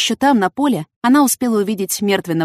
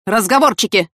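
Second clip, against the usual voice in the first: sharp, moderately raised command; mild shout; 3 semitones higher, 4 dB louder than usual. A narrator's reading. Lieutenant stopping the detainees' talk, mildly shouted. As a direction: call out to stop the chatter. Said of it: "крикнул лейтенант".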